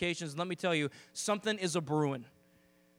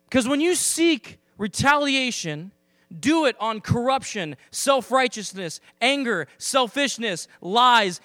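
louder, rousing call to the church; preaching at full force; 2 semitones higher, 6 dB louder than usual. Acted. Preacher urging the church to go after sin, rousing. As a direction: drive it home, building.

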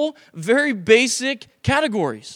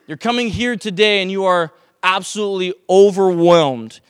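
ordinary delivery

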